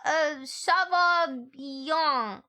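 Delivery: ça va bien dragged out very slowly; slow mock French. Shown as a demonstration. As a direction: stretch each French syllable. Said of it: "mock French chat".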